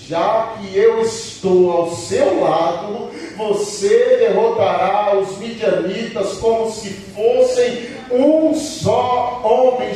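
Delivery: slow, weighty promise that climbs at the end; emphatic preaching. Acted. The Lord promises Gideon victory over the Midianites, emphatic preaching.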